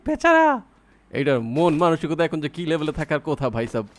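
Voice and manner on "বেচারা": laughing, much higher, slow poor-thing; mock pity. Streamer mocks the enemy he just downed, laughing pity. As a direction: squeal a pitying poor guy through laughter.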